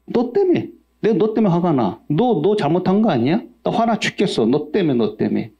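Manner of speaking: high, mock-angry blaming spouse; preacher imitates a spouse blaming the other, high and mock-angry; quarreling spouse. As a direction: whine the blame like a spouse in a fight. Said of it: "부부싸움 할 때 그러지 않습니까?".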